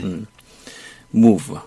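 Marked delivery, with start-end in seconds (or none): 1.1-1.5 "move" ending in V, lower lip lightly bitten then released. Shown as a demonstration. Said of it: "살짝 물었다가 내뱉듯이".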